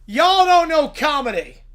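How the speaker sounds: normal talking voice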